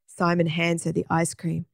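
usual speaking voice

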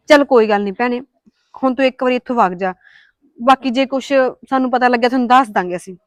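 loud brush-off at the door; loud dismissal. Acted. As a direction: call it out to get rid of her. Sending the woman away.

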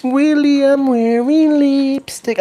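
high, slow, squealing schoolyard taunt; mocking kids. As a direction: tease like a bratty kid. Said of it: "I'm like".

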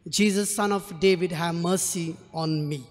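normal talking voice

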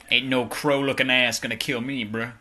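ordinary talk, nothing marked

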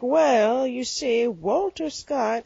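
imitated lecturing elder, drawn out on the name; father-in-law's lecture. Daughter-in-law imitating father-in-law's correction, lecturing voice. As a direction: explain it slowly, as an imitated pedant. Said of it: "Your dad sounds nothing like that".

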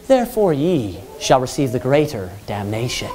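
limp, half-hearted preaching; mock timid reading. Preacher mocking a timid reading of damnation, meek and soft. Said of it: "Do you think that that's how he's preaching?".

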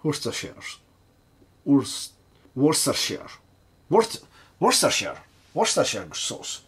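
stumbling retries of "Worcestershire", breaking off and restarting; repeated pronunciation attempts. Real, not acted. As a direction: practise saying "Worcestershire" as WOR-ster-sher. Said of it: "Do you say like that?".

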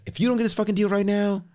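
imitating a pushy salesman creating urgency. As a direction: rush them like a pushy broker. Voicing pushy salesman pitch.

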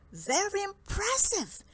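impressed exclamation, pitched high; high-pitched admiring exclamation from a mouse; exclaiming. A narrator's reading. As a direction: exclaim it with a big high swoop. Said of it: "exclaimed the mouse".

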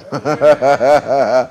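low rolling laugh; prophetic laughter. Real, not acted. Preacher laughing over a promised enlargement, low sustained laughter. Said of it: "Start laughing".

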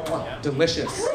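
ordinary talk, nothing marked

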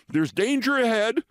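high, urgent warning; alarmed secretary bird. Acted. Secretary bird warning the owl, high and urgent.

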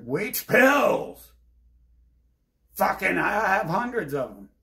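loud, high-pitched outburst; exasperated yell. Retold or imitated. Answering people who say take your pills, exasperated yell. Recounts yelling back about pills.

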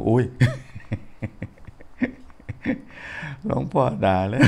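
startled friend laughing through her words. Retold or imitated. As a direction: giggle it out in mock alarm. Friend laughing: monk scolded us.

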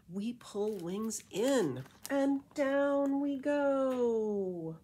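lilting rhymed storybook read; reading to children. A narrator's reading. Reading a bat beach story to kids, lilting rhyme. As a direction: read it like a bedtime picture book. Reading rhyming picture book aloud.

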